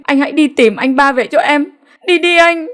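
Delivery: high, begging cry rising at the end; pleading. A narrator's reading. Wife begging brother-in-law to find her husband, high pleading. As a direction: implore him to go search. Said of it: "nói như van lơn".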